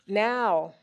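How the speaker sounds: plain reading voice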